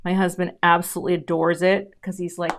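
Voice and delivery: ordinary talk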